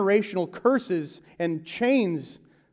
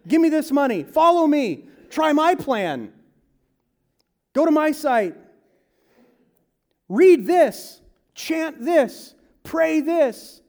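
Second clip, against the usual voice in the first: higher, louder barrage of demands; mock the crowd of hawkers pushing their fixes; pushy sellers; 8 semitones higher, 4 dB louder than usual. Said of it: "everyone's coming to him saying".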